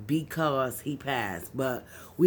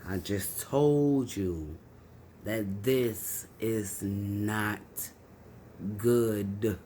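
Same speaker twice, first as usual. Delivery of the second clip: a low, drawn-out retold warning to mom, words stretched slowly; slow, low retold dread; 7 semitones lower, 4 dB quieter, much slower than usual. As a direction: say each word slowly and low, as if dread has come true. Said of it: "And I'm like".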